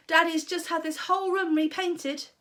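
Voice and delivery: put-on young George fretting; boy's voice. A narrator's reading. George warning Grandma about the room.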